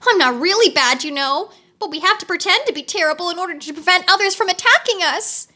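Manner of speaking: high, fast pleading; wheeler voice. A narrator's reading. Wheeler explains himself to Dorothy, high and quick. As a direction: rush to justify himself.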